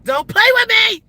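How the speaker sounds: stern mom to son. Retold or imitated. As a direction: scold your son half-laughing, mock fierce. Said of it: "This how I used to be with my son".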